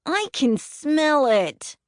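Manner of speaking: put-on high, slow, grossed-out kid voice; disgusted little boy. A narrator's reading. Boy complaining about the smell, high and disgusted. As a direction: whine it like a six-year-old.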